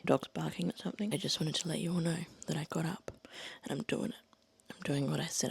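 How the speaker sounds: hushed dawn whisper; quiet at night. Real, not acted. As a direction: whisper it so nobody wakes. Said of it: "trying to be really quiet".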